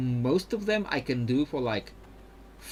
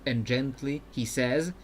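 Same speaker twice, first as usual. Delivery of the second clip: ordinary talk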